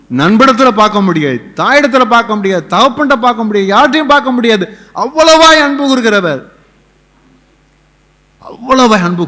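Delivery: high, loud surge, then easing; impassioned preaching. Acted. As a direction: cry out the greatness of that love. Preaches at full force. (உரத்த உணர்ச்சிப் பிரசங்கம்)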